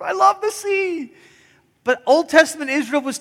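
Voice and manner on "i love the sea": gushing vacationer. Retold or imitated.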